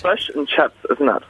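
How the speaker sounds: "Fish and chips" with vowels near fush and chaps; New Zealand pronunciation. Shown as a demonstration. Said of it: "that's the way they talk".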